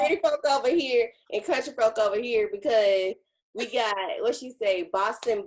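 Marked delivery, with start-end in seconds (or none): 0.0-2.5 mocking stepmom. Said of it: "She said".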